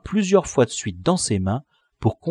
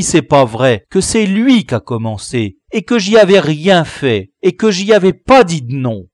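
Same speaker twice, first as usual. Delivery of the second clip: noble outcry; 7 dB louder than usual. Acted. Camus appeals to the teacher, loud and proud. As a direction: plead your case with dignity. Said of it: "s'écria noblement".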